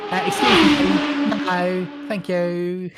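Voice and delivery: put-on high, slow camp Essex accent. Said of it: "in a strong Essex accent as well".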